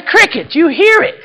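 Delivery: high, loud, excited repeat; insistent question. Acted. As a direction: push the question up high and punchy. Repeats question to baffled businessman.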